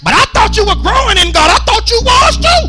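high, loud, strained shout of a hurt spouse; acted quarrel shout. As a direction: yell it like the argument is boiling over. Acted quarrel between husband and wife.

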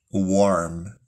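ordinary talk

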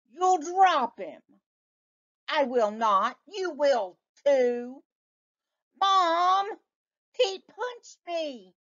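put-on high whiny child voices; high squabbling children; bickering kids. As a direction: tattle like a little brother. Boys arguing voiced.

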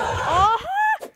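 sudden high yelp of surprise; surprised yelp. Real, not acted. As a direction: jump at it with a high, loud yelp.

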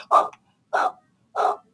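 short voiceless puffs of breath; huffing and puffing. Acted. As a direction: puff on freezing paws. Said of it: "huffing and puffing on his paws".

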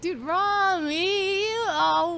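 very drawn-out, high-pitched aww voice; sympathetic cooing. Retold or imitated. Friends melting over their tearful pal, high drawn-out coo. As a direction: stretch the name into a high coo.

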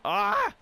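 sharp high yelp of frustration; a sudden pained cry at the miss; frustrated yelp. Real, not acted. Frustrated yelp at bad play.

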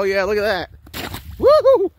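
ordinary delivery

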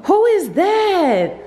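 loud, high call across room. Real, not acted. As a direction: call her name from afar. Calling out to Lyric.

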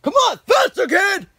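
high, loud shouting order; drill sergeant yell. Acted. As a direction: bark it across the field. Sergeant yelling at runner.

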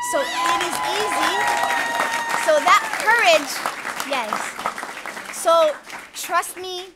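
proud loud declaration; rallying shout. Real, not acted. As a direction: fire up the room. Rallying the crowd on courage.

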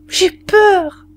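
put-on high, frightened child's call; child's cry. A narrator's reading. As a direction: cry out like a scared little one. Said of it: "se réveillèrent et crièrent".

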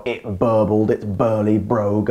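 plain reading voice

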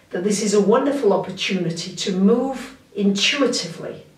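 usual speaking voice

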